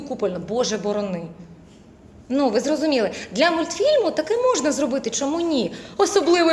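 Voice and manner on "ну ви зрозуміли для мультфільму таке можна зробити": usual speaking voice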